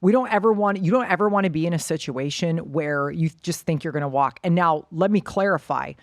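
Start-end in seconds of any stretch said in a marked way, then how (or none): none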